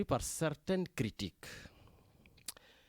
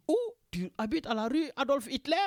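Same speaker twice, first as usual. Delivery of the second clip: higher, surprised voice of someone mishearing; puzzled listener mishearing; 9 semitones higher than usual. Retold or imitated. Marseille locals mishearing his street, higher and surprised. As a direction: play the baffled listener asking back. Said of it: "Ils me disent".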